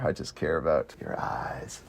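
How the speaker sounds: breathy low whisper, slow; creepy whisper. Retold or imitated. Creepy movie line quoted in whisper.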